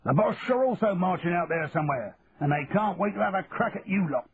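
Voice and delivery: grim warning to the recruits; sergeant major's warning. Acted. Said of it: "the sergeant major assured them".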